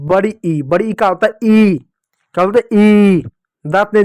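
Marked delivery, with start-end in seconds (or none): 0.9-0.9 ई held as a long "eeee"; sustained long ई; long vowel. Shown as a demonstration. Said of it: "लंबा उच्चारण होगा". 2.8-3.2 ई held long and a bit louder; sustained, falling long ई; long vowel. Shown as a demonstration. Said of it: "लंबा उच्चारण होगा".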